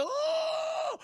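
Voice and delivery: very high, loud mock scream; startled scream. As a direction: scream as the man seeing a monster. Man screams at monster.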